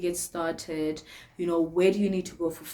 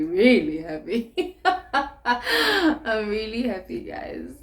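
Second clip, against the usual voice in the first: slow, giggly gushing; laughing happily; slower than usual. Real, not acted. Vlogger gushing about her boyfriend, laughing. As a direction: gush through the laughter.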